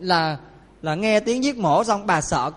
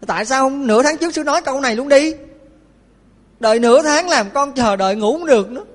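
raised, louder, exasperated scolding; a mother complaining bitterly to the monk, higher and louder; angry complaint; 5 semitones higher, 3 dB louder than usual. Acted. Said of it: "Bà này bà giận lên". (giọng trách móc giận dữ, cao và to)

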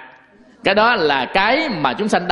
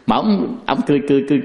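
ordinary delivery

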